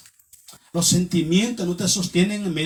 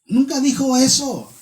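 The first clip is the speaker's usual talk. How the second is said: ordinary talk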